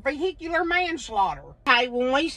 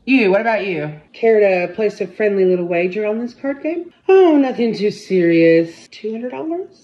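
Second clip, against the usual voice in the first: sly hustler voice; 5 semitones lower, 6 dB louder than usual. Acted. Card shark hustling inmates.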